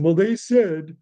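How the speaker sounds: mocking the obedient public; mock sheep voice. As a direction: parrot them with scorn. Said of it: "most of the population".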